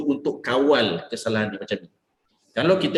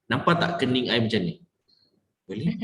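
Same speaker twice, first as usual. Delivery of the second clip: usual speaking voice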